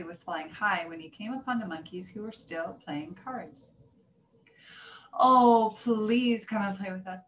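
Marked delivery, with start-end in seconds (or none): 5.1-7.2 loud, drawn-out begging. A narrator's reading. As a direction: beg loudly, stretching the first words. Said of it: "begged the monkeys".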